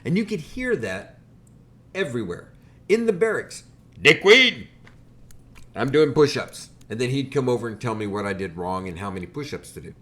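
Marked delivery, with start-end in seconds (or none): 4.0-4.7 loud barked insult, much higher; drill sergeant yell. Retold or imitated. Drill instructor yelling the nickname at recruit. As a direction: bellow it like a drill instructor across the barracks.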